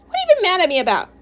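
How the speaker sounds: high, fast exasperated question; bickering partner, quick and indignant. Acted. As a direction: fire back in a rushed, high voice. Quarrel between Mom and Xavier.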